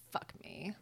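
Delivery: breathy whispered curse. Retold or imitated. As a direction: mutter it under your breath. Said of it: "I was like".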